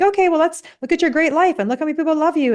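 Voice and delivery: high-pitched, over-eager reassurance; hurried cheering-up. Acted. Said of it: "shit them out of that really quickly".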